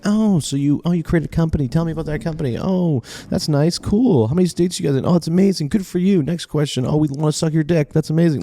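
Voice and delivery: high, sugary mock interviewer; sarcastic high-pitched gushing host voice; fake fawning interviewer. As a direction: gush at the guest in a falsetto. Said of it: "be like".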